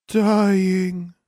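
high, breathy dying plant voice; a dying plant sighing its answer; sad whisper. Acted. Dying corn plant whispers sadly to the children. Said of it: "whispered a sad voice".

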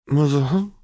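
just woken, blurting it out; sleepy Jinx. A narrator's reading. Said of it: "Jinx mumbled".

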